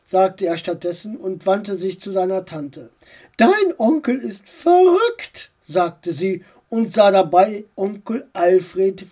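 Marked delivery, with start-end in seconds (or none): 3.4-5.5 high, slow aunt voice; aunt mocking the uncle; reproachful aunt. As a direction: drawl it reproachfully.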